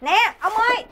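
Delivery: loud, high-pitched protesting call; calling after him. Acted. Wife calling after husband leaving to drink, loud protest. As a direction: shout after him as he walks off.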